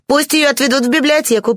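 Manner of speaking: put-on high-pitched commanding young lady; imperious. A narrator's reading. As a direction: give the order loftily, pitched high. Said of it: "приказал ангел повелительным тоном". (повелительным высоким тоном)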